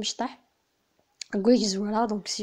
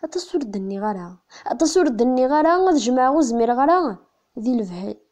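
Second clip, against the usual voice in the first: poem recitation; 6 dB louder than usual. Acted. (taɣuri n usefru)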